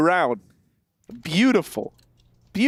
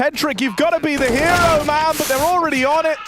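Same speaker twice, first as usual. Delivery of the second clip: excited caster call; 7 semitones higher, faster than usual. Real, not acted. Caster calling a tense defuse.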